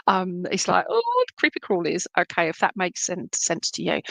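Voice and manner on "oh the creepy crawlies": high, squeamish mock shudder; mock fright. Medium voicing the dad's dread of spiders, high and squeamish. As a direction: shrink from the creepy crawlies. Spirit recalls spiders in outside loo.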